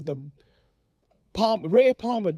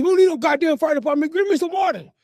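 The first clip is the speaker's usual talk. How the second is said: loud, high, cursing bellow; angry father shouting; 9 semitones higher, 6 dB louder than usual. Retold or imitated. Father to mother during the fire, angry shouting. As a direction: imitate a dad overruling in a panic.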